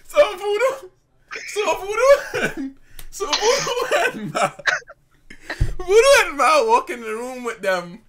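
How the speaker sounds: high, loud, stretched words breaking into laughs; talking through laughter. Real, not acted. Storyteller restarting the tale of Voodoo, helplessly laughing. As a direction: fight to get it out while cracking up.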